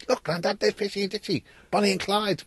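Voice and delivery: put-on high little girl calling grandad; excited child pointing out the mice; granddaughter's voice. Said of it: "My little granddaughter comes and says".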